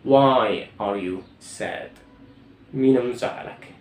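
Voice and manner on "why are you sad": Why are you sad said very slowly and clearly; teacher spelling out the question; slow classroom drill; slow model question. Shown as a demonstration.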